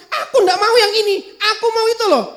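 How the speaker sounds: put-on high, fast whiny child; bratty whine. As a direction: complain like a spoiled kid.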